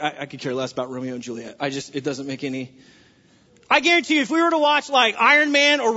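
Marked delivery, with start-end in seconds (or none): 0.0-2.7 low, soft mock-bored dismissal; bored teen. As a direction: shrug it off like a bored student. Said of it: "you're like".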